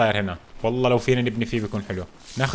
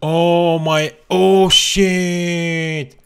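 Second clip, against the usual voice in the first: long drawn-out, louder cry of amazement; awestruck exclamation; 4 semitones higher, 6 dB louder, much slower than usual. Real, not acted. Player stumbling on forty screwdrivers, awestruck exclamation. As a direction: stretch the last word out in amazement.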